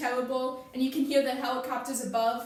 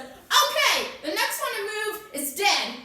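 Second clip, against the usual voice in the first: loud, high-pitched command at gunpoint; cop's shouted threat; 8 semitones higher, 7 dB louder, slower than usual. Acted. Cop pulls his gun on everyone in the car, shouted threat. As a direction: shout a deadly warning.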